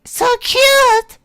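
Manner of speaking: put-on high, loud girlish squeal; squealing cute. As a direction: gush over something adorable.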